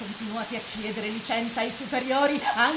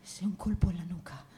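softer, lower whisper; 6 semitones lower, 8 dB quieter than usual. Acted. Young man proposing a blow to the neck, whispered. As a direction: suggest it in a hush. Plotting the killing.

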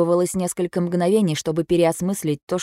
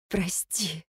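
much softer, a breath of an apology; whispered sorry; 11 dB quieter than usual. Acted. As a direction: barely voice the word. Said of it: "прошептала я".

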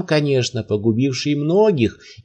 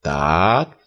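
more mocking; 8 semitones lower than usual. A narrator's reading. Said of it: "с ещё большей насмешкой в голосе".

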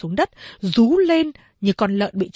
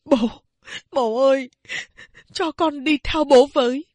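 vừa khóc vừa nói; 4 semitones higher than usual. A narrator's reading. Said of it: "vừa khóc vừa bảo".

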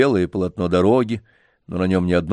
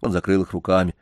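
usual speaking voice

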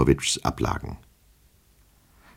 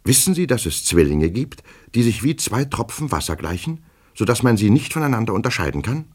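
high, urgent questioning; anxious clerk pressing his case; agitated petty clerk; 8 semitones higher than usual. Acted. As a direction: speed up as the point builds.

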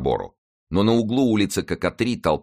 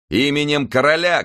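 loud, higher commanding call; officer's command shout; 9 semitones higher, 5 dB louder than usual. A narrator's reading. Comminges calling out in the king's name, loud commanding shout. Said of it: "кричал Каменш".

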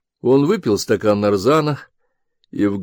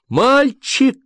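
much higher cry to the son; shout; 4 dB louder than usual. A narrator's reading. Mother shouting for her son, high. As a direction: call the boy in a high shout. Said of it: "крикнула Людмила Аркадьевна".

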